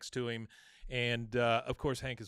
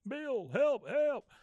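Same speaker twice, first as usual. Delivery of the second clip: Bill's pleading voice, pitched very high; high cry for help; 12 semitones higher, much slower than usual. Retold or imitated. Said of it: "out in the alley, you hear".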